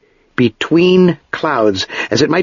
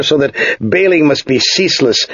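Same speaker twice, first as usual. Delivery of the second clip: ordinary delivery, nothing marked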